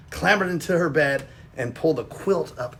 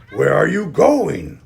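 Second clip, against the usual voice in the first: slow growling wolf voice; growled; 2 semitones higher, 7 dB louder, slower than usual. Acted. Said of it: "growled the wolf".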